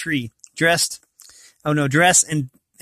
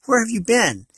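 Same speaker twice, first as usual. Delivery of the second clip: higher-pitched scolding, falling away at the end; 7 semitones higher than usual. A narrator's reading. Molly to the unicorn she has awaited, scolding. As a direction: scold like someone finding a strayed cow. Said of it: "scolding her as though she".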